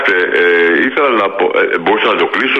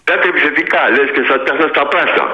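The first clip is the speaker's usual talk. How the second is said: agitated protest; 3 semitones higher than usual. Real, not acted. Caller accuses host of being aggressive, agitated.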